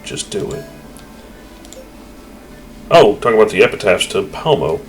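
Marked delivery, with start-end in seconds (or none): 0.0-0.6 whispered Just do it, breath only; Just do it whispered under the breath. Shown as a demonstration. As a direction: hush the words down to a whisper. Repeats line, then whispers it.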